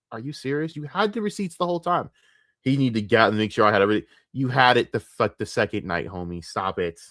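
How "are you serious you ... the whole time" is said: higher-pitched annoyed disbelief. Retold or imitated. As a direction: retell your exasperation, pitched up without shouting. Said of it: "And I'm, I'm annoyed".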